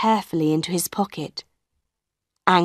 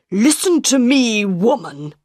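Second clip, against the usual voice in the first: higher-pitched, indignant order, mildly acted; angry; 4 semitones higher than usual. A narrator's reading. Red-faced director rounds on Madame Giry, mildly acted anger. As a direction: start high with anger, then drop. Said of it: "his face was red and angry".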